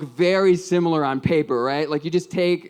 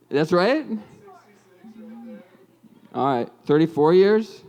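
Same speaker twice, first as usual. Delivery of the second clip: usual speaking voice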